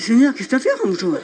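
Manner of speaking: wife sees the wad of bills, laughing amazed; laughing disbelief. Retold or imitated. Shocked joy seeing the cash.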